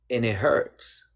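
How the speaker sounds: ordinary talk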